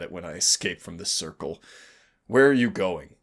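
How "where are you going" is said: calling out. Acted. Trapped demon calls after partner.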